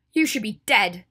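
loud, cold condemnation; cold anger. A narrator's reading. Ginny has just slapped Draco twice, speaking with cold fury. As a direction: pronounce it forcefully, with cold hatred. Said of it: "Ginny said coldly".

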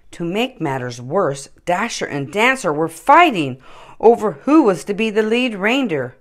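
ordinary delivery

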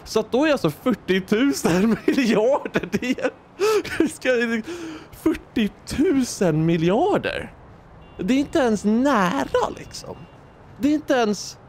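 high, cracking voice, half crying with laughter; can't believe it, laughing through the words; laughing disbelief. Real, not acted. (skrattande och gråtfärdig av misstro, ljus röst)

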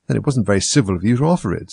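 ordinary delivery

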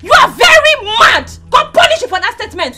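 loud, furious cursing; angry outburst. Acted. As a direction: hurl it as an angry shout. Cursing the other in a quarrel.

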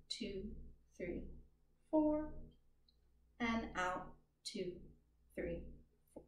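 pace the group's breathing gently; counting breaths. Acted. Counts a paced breathing exercise.

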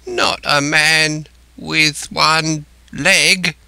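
gasping dread. A narrator's reading. Castaway asking Jim about one-legged man, gasping. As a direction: gasp it word by word. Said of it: "he gasped".

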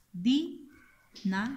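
"Dinamo" in three slow syllables, di, na, mo. Shown as a demonstration. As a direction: dictate "Dinamo" with pauses between syllables. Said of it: "Aquí está la sílaba acentuada".

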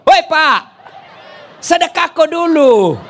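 loud, high call across the house; wife calling out. Retold or imitated. Wife yelling to her husband to give his kiss first, loud mock call. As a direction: holler at the husband to pay up.

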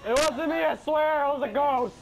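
high, loud mock innocence; mock protest. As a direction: protest your innocence, hamming it up. Joking denial after killing.

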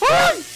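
sudden high yelp of fright; startled shriek. Acted. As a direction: yelp as if something grabbed you. (grito agudo de susto)

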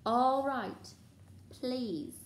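exasperated surrender. A narrator's reading. Filch gives in to Peeves.

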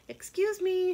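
high, bright hen calling out the window; high hen voice asking politely; little red hen voice. Acted. Hen voiced in read story.

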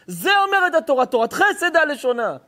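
high, loud, fervent sermon voice; preaching at full force. Acted. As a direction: drive the verse home to the congregation. Rabbi preaching hard to crowd.

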